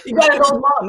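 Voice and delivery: ordinary talk, nothing marked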